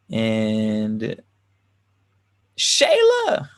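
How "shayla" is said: high called-out hello; excited greeting. Real, not acted. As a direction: sing out the name in delight. Greets viewer joining the stream.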